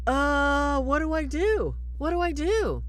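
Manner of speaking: high, frantic mock panic; panicked. Trainee Michael left alone with the possessed girl, mock panic. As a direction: flail for help, half-laughing. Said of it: "Michael's like".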